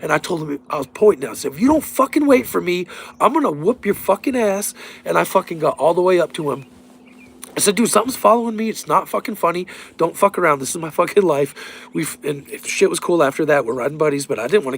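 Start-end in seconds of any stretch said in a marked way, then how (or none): 1.5-4.7 high, loud furious threat at a lagging buddy; retold angry shout, pitched way up. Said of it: "and I'm fucking pissed". 7.8-11.5 scared yell. Retold or imitated. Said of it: "I'm yelling at him".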